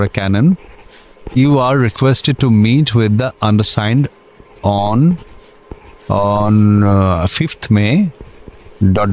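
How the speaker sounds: each English word spelled out very slowly; officer dictating a letter at a crawl; slow dictation. Acted. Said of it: "একটা dictation নিন তো".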